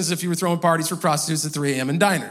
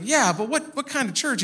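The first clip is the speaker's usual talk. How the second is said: higher-pitched, puzzled retold owner; insistent questioning; 7 semitones higher than usual. Diner owner pressing the preacher about his church, puzzled. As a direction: press for an answer as the baffled owner.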